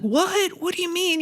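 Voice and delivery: high-pitched mock bewilderment; retold disbelief. Retold reaction to being sent home.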